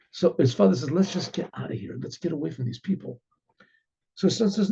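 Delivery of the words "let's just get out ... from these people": softer, discreet aside. Retold or imitated. Father to his family about these people, quietly urging them off. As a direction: keep it down, urging the family away.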